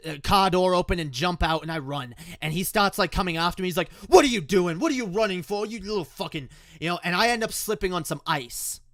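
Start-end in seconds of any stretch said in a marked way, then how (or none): 4.1-6.5 raised, agitated demand; imitated angry shout. Dad coming after his fleeing kid, angry shout. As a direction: call after them, high and heated.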